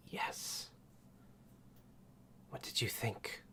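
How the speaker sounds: whispered snake lady; hushed serpent woman; snake mom voice. Acted. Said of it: "Snake Mom's voice".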